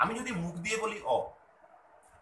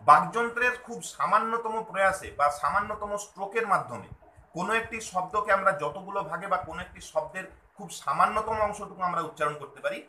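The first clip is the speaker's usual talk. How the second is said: ordinary talk, nothing marked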